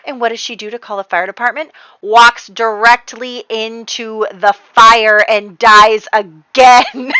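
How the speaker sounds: ordinary delivery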